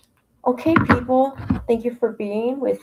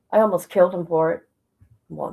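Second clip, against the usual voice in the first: ordinary talk, nothing marked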